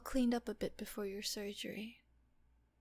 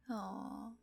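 ordinary delivery

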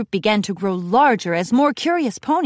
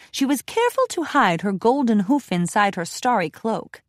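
usual speaking voice

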